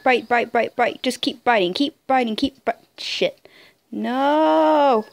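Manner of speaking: ordinary talk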